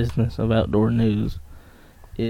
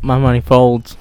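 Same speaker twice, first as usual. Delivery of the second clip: shouting; 9 dB louder than usual. Real, not acted. Comic retort, shouted.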